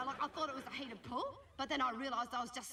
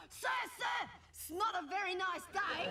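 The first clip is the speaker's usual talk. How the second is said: mock teasing call; 6 semitones higher than usual. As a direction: call it out to tease the teacher. Kids teasing the teacher.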